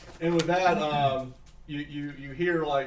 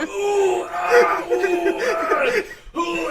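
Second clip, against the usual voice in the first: high, loud ooh-ah cries while spinning; spun-round gnome crying out; high yelps of dizziness; 8 dB louder, much slower than usual. Acted. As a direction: wail each ooh and ah up high. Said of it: "You hear her go".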